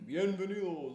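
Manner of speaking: high booming welcome; announcer welcome. Acted. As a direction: call it to the crowd. First take of intro.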